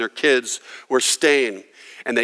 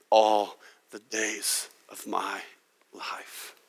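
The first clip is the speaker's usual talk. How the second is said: fading whisper; 6 semitones lower, 10 dB quieter, slower than usual. Acted.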